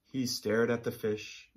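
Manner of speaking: ordinary talk, nothing marked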